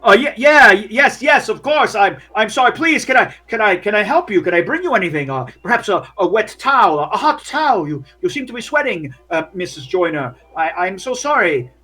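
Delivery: grovel nervously to Missus Joyner; flustered fawning worker. Acted. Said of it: "he's awkward".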